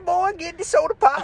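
put-on high, thin old codger voice with a cane; rickety old man. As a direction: play a creaky old man grumbling at a kid. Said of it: "a rickety old man with a cane".

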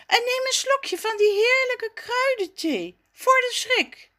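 very high-pitched, coaxing figure voice; comforting offer. A narrator's reading. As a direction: coax them to take something for the shock. Zaza offers tea to Jacques. (troostend aanbieden, met heel hoog stemmetje)

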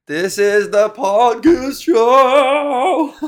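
high, loud, slow show announcement; big announcer opener. Shown as a demonstration. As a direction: belt it like a game-show host. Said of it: "pretty decent for an improvised opener".

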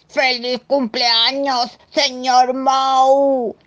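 high, loud, long-drawn monster cheer; slow, hooting birthday holler; monster's birthday shout. Acted. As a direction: stretch every word, holding the last one.

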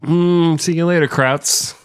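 high, breezy mock-casual voice; cocky Soviet tanker. Soviet tankers taunting the Germans as they pass, breezy. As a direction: wave them off like a joke.

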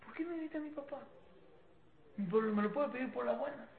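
put-on soft inner voice of a scolded child; lightly voiced child, hushed complaint; hurt child. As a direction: quietly wonder like a child who was yelled at. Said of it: "el niño, ¿qué está diciendo?".

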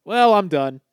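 loud deadpan surrender; mock giving up. Man retelling how he gave up arguing, loud deadpan. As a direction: throw up your hands, loudly. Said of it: "I was just like".

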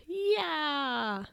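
high, drawn-out yeah; a held-back high whoop; cheer. Acted. Cheer voiced in the story.

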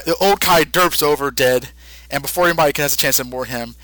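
normal talking voice

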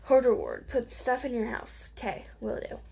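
ordinary delivery

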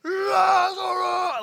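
drawn-out, high bellow; huge, stretched-out roar; booming shout. Acted. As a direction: yell the name long and loud as if to wake the dead. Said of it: "imagine how he's like".

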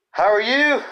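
big high loud greeting. Acted. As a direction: call out the greeting. Greeting, repeated quietly after.

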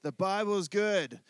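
hearty call and response line; call to repeat. Shown as a demonstration. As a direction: lead the congregation in the response.

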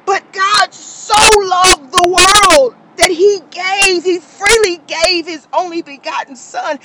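crying out the verse, voice breaking; real tearful cry. Real, not acted. Preacher weeping over God's sacrifice, crying shout. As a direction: shout it through tears.

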